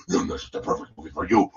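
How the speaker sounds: raspy, rushed voiceless croak; hammed-up creepy rasp; spooky ghoul. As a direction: rasp it like a horror host. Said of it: "I just keep getting carried away".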